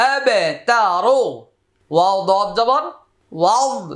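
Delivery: Quranic spelling drill. Shown as a demonstration. Teacher modelling Arabic syllables for students to repeat, measured drill chant. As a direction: spell it out, then sound it in a lilting model voice.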